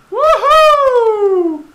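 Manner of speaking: long high cheering woohoo; gleeful celebratory yell; excited whoop. Real, not acted. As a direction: cheer it out in triumph. Cheering in game.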